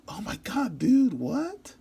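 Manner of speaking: high-pitched stunned reaction; acted disbelief. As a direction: jump up high in astonishment. Acted shock at hanging finger.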